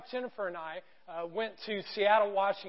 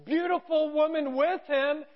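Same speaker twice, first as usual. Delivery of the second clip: raised, sing-out vendor's call; imitated holler, higher pitched; retold market call; 5 semitones higher than usual. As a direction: announce it to the whole market. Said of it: "and they go".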